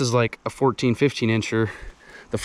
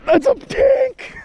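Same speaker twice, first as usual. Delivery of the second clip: a thrilled, drawn-out cry; excited shout; 4 dB louder, much slower than usual. Real, not acted. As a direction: stretch it out in high delight. Huge fish on the line.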